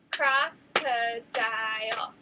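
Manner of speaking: slow, syllable by syllable Crocodile; clapped syllables. Shown as a demonstration. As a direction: break Crocodile into its beats, slowly. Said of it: "Let's see if we can clap it together".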